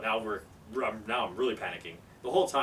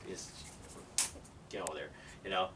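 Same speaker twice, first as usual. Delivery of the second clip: normal talking voice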